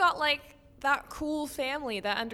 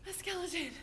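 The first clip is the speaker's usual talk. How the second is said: hushed, breath-short disbelief; stunned fear; 4 semitones higher, 9 dB quieter, much faster than usual. Acted. Girl repeating it to herself, hushed stunned fear. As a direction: say it small and soft, in shock.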